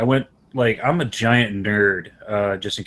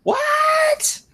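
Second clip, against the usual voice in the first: a sudden high yelp of disbelief; shocked what. Real, not acted. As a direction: squeak out a stunned what. Shocked nobody knows Goodfella.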